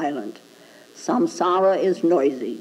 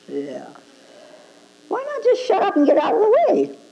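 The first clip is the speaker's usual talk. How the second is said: ordinary talk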